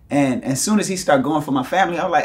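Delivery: usual speaking voice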